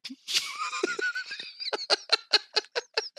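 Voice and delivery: very high, long squeal of laughter; helpless high giggle. Real, not acted. Host cracks up.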